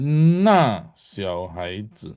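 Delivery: NA pressed hard, then slow XIAO HAIZI; careful model of NA XIAO HAIZI, stress on NA; pressed fourth tone. Shown as a demonstration. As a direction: lean on NA and space out XIAO HAIZI. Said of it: "agak tekan".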